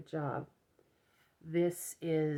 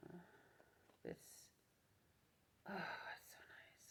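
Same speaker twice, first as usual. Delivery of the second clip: whispering; 2 semitones higher, 20 dB quieter than usual. Real, not acted. Admiring yarn softly.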